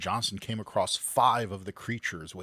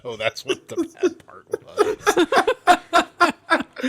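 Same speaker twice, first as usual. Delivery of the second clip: laughing high through the words; breaking into giggles while talking; 9 dB louder, slower than usual. Real, not acted. Laughing at failed accent.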